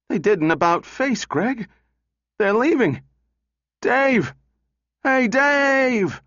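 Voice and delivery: high-pitched, urgent cries; rising panic into a high, drawn-out call; alarmed calling out. Acted. Donovan sees the robots leaving, calling out in alarm.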